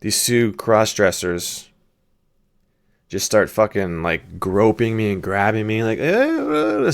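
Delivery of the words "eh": high-pitched mock pestering noise; pestering street hustlers. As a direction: imitate gropers with a high whine. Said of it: "speaking in Spanish and shit like that".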